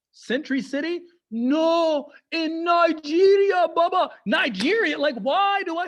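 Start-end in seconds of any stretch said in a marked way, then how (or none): 1.3-4.1 higher, louder, drawn out; mock exasperated dad. Dad tells son the murders were in Nigeria, loud and outraged. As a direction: insist loudly like an outraged father. Dad answering which mall.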